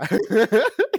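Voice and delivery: 高声爆笑; 忍不住放声大笑; loud burst of laughter. Real, not acted. 考试玩笑后大笑. (放声大笑)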